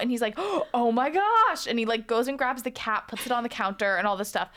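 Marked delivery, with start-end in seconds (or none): 0.4-1.7 high, drawn-out mock amazement; slow, gushing squeal of delight; freaking out. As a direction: imitate someone overjoyed by a gift. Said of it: "he is freaking out".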